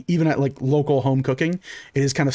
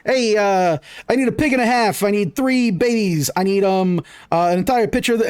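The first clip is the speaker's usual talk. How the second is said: cook calling out orders; 6 semitones higher than usual. Acted. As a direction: shout the orders back to the kitchen. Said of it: "starts calling out all these".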